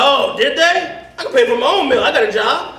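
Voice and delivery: high, prickly indignation; offended protest. Pretend. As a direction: protest up high, wounded pride. Indignant at drive-thru.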